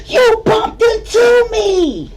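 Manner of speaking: high, slow, loud cry of outrage as Feisty; shrill accusing yell. Retold or imitated. Feisty yelling at the stranger, high and outraged. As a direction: squeal it out like she's been wronged.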